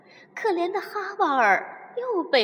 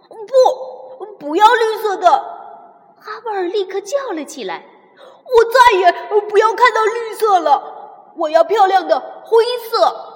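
loud, higher cry of refusal; outburst; 8 semitones higher, 12 dB louder than usual. Acted. Havard refusing green clothes to the clerk, loud. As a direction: shout no, fed up with green.

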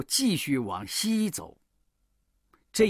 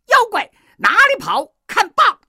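high, very loud heroic challenge; battle shout; 12 dB louder than usual. A narrator's reading. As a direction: shout it at a fleeing demon. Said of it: "大叫一声". (孙悟空高声大喝)

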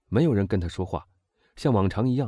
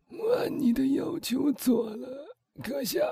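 slow, high, strained failing voice; hoarse dying woman; 4 dB quieter, slower than usual. A narrator's reading. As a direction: force the words out through a failing throat. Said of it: "她声音嘶哑". (嘶哑艰难地说)

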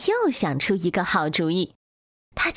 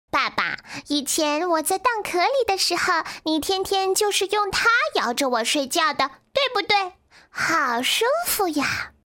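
put-on high-pitched, childlike duckling chatting to daddy; little duckling; 7 semitones higher than usual. A narrator's reading. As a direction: voice a baby duckling, high and contented. Plays the duckling. (小鸭子尖细的童声，撒娇)

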